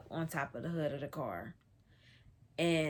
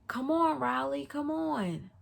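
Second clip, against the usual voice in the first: higher-pitched urgent call; imitated urging; 7 semitones higher than usual. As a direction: call her along as you flee. Cousin calling while fleeing, retold.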